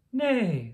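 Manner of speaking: horse's neigh. Acted. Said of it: "said a horse".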